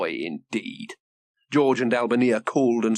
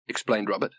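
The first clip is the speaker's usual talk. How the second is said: ordinary delivery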